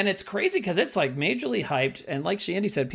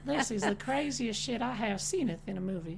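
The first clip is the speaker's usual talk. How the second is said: Southern belle; 7 semitones higher, 4 dB quieter than usual. Acted. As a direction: play a genteel Southern belle. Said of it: "like a Southern belle".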